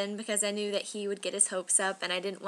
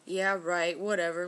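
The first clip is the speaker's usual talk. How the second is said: sulky dismissal; 4 semitones lower than usual. Retold or imitated. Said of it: "he'd get all sulky".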